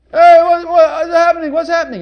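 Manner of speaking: high, loud urgent calls; a blind beggar calling out to the passing crowd; loud anxious calling. Acted.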